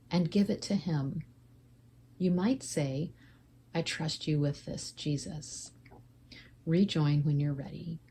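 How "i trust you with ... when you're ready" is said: normal talking voice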